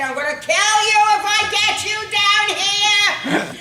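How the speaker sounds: high, very loud angry threat; furious yell. Acted. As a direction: scream it up at your kid on the roof. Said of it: "and I'm still mad".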